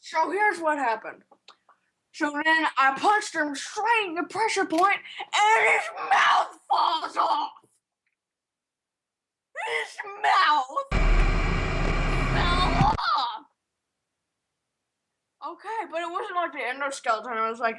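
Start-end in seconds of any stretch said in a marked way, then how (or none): 2.1-13.5 high, hammed-up cry of amazement; excited storytelling. Excited climax of fight story.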